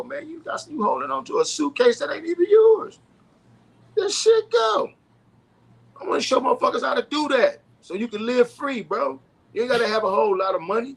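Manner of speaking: fired-up preaching. Acted. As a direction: preach it like a street pastor rallying the crowd. Preaching life advice to listeners.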